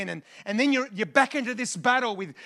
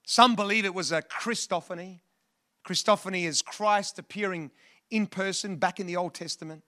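usual speaking voice

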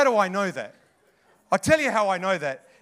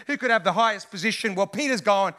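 ordinary talk, nothing marked

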